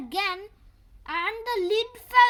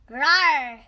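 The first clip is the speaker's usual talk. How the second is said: growl; 6 semitones lower, 5 dB louder than usual. Acted. Cartoon fight sound effects.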